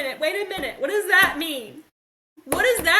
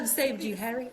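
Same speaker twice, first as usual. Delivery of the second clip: soft, lower mock Dumbledore line; quoting Dumbledore; 4 semitones lower, 5 dB quieter than usual. Friend quoting a movie line to the book club, softly. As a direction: hush it like a wise old wizard quote. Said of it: "like in Harry Potter?".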